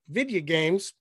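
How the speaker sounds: lightly imitated cartoon way of saying "video games"; a hint of impression on "video games"; Hank Hill. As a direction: run "video" together as "vidya" in "video games". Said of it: "Hank Hill would say it".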